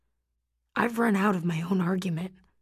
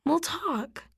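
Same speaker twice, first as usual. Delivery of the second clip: assuring; 8 semitones higher than usual. A narrator's reading. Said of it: "she assures me".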